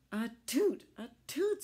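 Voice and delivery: high, loud sneezing toot; squeaky tugboat sneeze. Acted. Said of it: "sneezed Alice".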